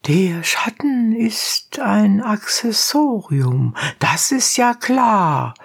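high, slow judge character; drawn-out high judicial voice; the judge's voice. A narrator's reading. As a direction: pronounce it slowly, pitched well up.

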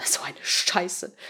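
drawn-out, hushed expletive; cursing. A narrator's reading. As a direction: swear under your breath. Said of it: "Patricia fluchte".

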